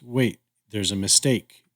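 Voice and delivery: normal talking voice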